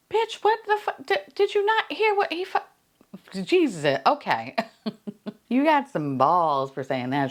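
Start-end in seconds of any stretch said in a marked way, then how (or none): 0.1-2.6 much higher, stammering outrage; outraged disbelief. Real, not acted. Host reacting to Lindsay, high and sputtering.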